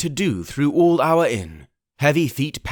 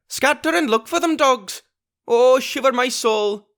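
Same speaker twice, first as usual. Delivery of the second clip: high, raging commands; angry cry; 12 semitones higher than usual. Acted. Pugh drives his fleeing crew, raging. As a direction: drive the dogs to search. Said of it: "he cried".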